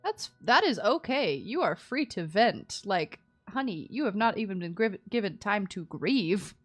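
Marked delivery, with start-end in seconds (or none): none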